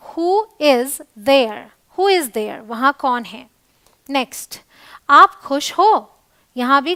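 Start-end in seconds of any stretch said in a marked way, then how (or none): 0.1-1.6 very slow, each word stretched; slow teaching demo. Shown as a demonstration. Teacher showing learners who is there, very slow. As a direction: spell the question out for students.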